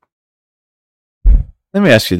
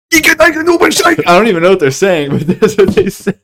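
much higher, louder mock German gibberish; 12 semitones higher, 5 dB louder than usual. Joking about foreign accents, hammed-up mock German. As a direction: ham up a German accent with laughter. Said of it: "Uh, German".

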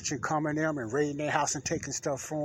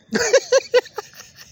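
nervous laugh; 6 dB louder than usual. Real, not acted. Laughing off the scare.

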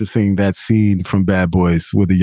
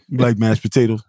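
ordinary delivery

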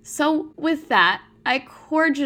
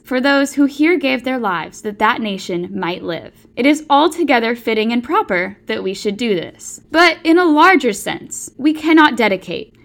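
rising oratory with heavy stresses; formal oration; 5 dB louder than usual. Acted. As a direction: deliver it like Lincoln at the ceremony. Recites the Gettysburg Address.

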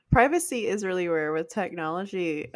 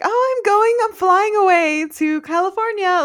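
much higher, louder gushing voice; mock excited; 12 semitones higher, 9 dB louder than usual. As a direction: gush about a glamorous trip. Mocking an exciting life.

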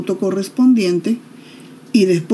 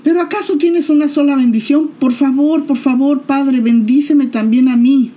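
alto y suplicante, al borde del llanto; ruego desesperado; 6 semitones higher, 4 dB louder than usual. Acted. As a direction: implora sin control. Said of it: "le ruega Esaú, ... se echa a llorar". (un toque de súplica llorosa de hijo al padre)

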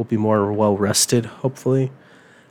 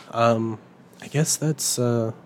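ordinary delivery

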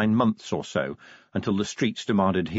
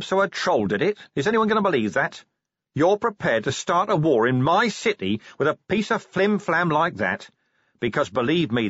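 heated commander, pitched up; Vimes, exasperated; 8 semitones higher, 3 dB louder than usual. A narrator's reading. Commander challenges the dwarf over flimsy evidence, exasperated. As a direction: argue indignantly, building into a threat of arrest.

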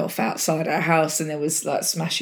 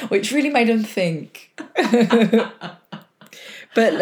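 ordinary talk, nothing marked

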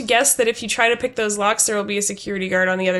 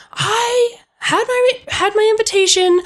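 high, affronted character voice; Aunt Gertie; 11 semitones higher, 3 dB louder than usual. Acted. Aunt giving her side of the story, affronted. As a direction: draw it out with wounded indignation. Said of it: "she starts to say".

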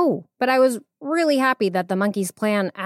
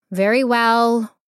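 slow, reluctant royal consent; drawn-out, put-upon agreement; exasperated queen; 5 semitones higher, 5 dB louder, slower than usual. A narrator's reading. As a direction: give in with an eye-roll in the voice. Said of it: "rolled her eyes and gave a mighty sigh".

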